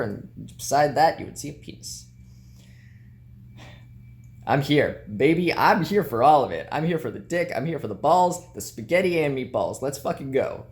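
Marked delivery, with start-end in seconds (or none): 4.5-10.7 louder, higher, fired-up cheer; excited hype. Real, not acted. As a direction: hype yourself up like a cheering fan. Hyped celebration.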